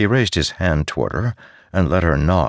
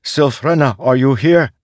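high, louder searching call; 9 semitones higher, 5 dB louder than usual. Acted. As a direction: call out into the darkness. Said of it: "he called".

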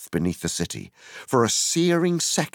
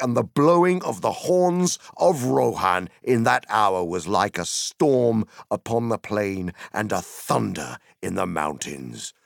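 ordinary delivery, nothing marked

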